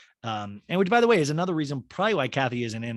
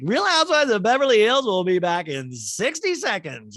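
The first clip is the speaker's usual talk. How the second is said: mock announcer; 9 semitones higher, 7 dB louder than usual. Announcing the commercial break.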